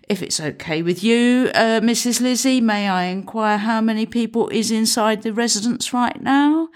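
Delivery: lightly voiced hesitant young officer; a touch of a nervous constable, a little quick at first; sheepish PCSO at the door, lightly voiced; young policeman's voice.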